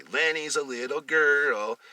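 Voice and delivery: slow, mocking sing-song taunt, lightly voiced; teasing. Brother teasing Lenny in a dress, lightly voiced. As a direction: tease the brother slowly. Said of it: "laugh and point at him".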